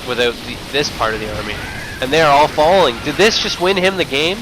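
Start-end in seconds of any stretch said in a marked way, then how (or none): 2.0-4.4 high, loud disbelieving call; excited. Real, not acted. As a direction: shout the comeback in disbelief. Caster calling the comeback.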